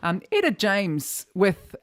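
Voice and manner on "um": ordinary talk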